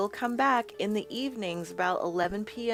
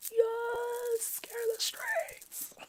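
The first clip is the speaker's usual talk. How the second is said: very high, slow, breathless whisper; squeaky high whisper; 14 semitones higher, 4 dB quieter, much slower than usual. Pretend. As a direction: squeak it out in a tiny voice. Repeats it as a squeaky joke.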